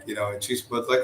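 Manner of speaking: ordinary talk, nothing marked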